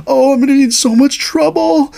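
high, worried mock panic; imitating own panic, high and anxious; panicked inner voice. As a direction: fret aloud like a lost driver.

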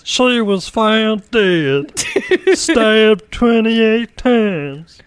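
mock true-crime host, dragging out every word; murder podcast voice. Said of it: "it's a murder podcast".

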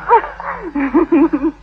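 light playful giggle; girlish giggle. Acted. Girl tangled in rope giggles at suitor, playful.

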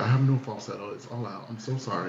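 quiet, worn-out hoarse voice. Real, not acted. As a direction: talk with a spent voice. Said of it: "I have no voice for this today".

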